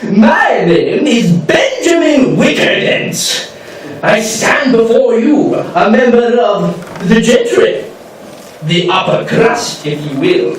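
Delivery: pompous aristocrat, swooping pitch, drawn-out key words; Benjamin Wickerdent. Acted. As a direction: play a haughty, grandiose lord who relishes ceremony. Plays pompous gentleman from eighteen hundreds.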